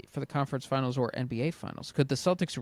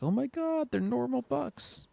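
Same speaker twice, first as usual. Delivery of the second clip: high-pitched pretend astonishment; hushed-crowd style high marvelling; mock amazement; 11 semitones higher than usual. Acts out astonished reaction to Bucks.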